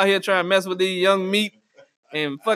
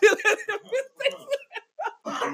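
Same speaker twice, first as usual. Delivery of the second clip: much higher, slow words through laughter; laughing; 5 dB quieter, much slower than usual. Real, not acted. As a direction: wheeze it out while laughing.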